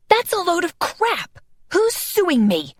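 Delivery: high, indignant shout, slowing on the question; outraged outburst. Acted. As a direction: blurt it out like someone falsely accused. Vonda leaps up, accused.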